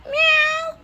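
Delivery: very high loud mock meow; cat meow. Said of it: "making that cat noise".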